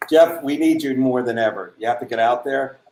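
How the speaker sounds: ordinary talk, nothing marked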